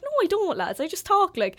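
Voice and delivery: high-pitched mock protest; jumping up high in playful denial; retold protest. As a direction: act out denying it to your friends. Acted denial of having an accent.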